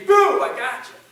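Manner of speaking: huge, high "boo" out of nowhere; explosive loud leap in range; sudden startling shout. Shown as a demonstration. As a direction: jump out and scare them. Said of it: "can't be off a cliff".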